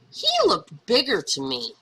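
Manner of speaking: ordinary delivery, nothing marked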